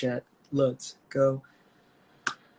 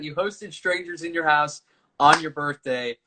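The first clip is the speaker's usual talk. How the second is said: ordinary delivery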